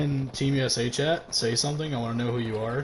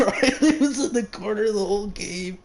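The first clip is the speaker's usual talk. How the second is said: laughing through the words; 5 semitones higher than usual. Real, not acted. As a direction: laugh out the reveal. Laughing reveal of the camper.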